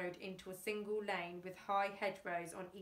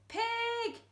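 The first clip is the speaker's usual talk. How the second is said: much higher sudden shout; 13 semitones higher, 4 dB louder than usual. Acted. Frank spotting a pig on the road, shouted. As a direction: blurt out the warning. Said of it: "shouted Frank".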